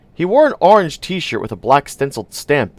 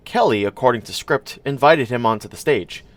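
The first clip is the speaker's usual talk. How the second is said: usual speaking voice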